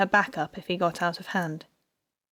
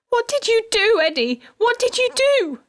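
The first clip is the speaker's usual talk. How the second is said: high, loud desperate cries; frantic yelling; 6 dB louder than usual. A narrator's reading. Wife confronting her arrested husband, frantic yelling. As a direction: demand it in anguish.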